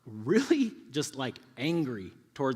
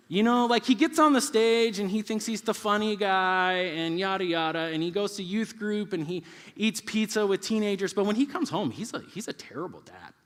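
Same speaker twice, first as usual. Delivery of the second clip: put-on louder, higher rant of a fed-up kid; indignant run-on grumbling; griping son; 7 semitones higher, 5 dB louder than usual. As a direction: complain about your dad like an exasperated child. Voicing son's complaint about dad.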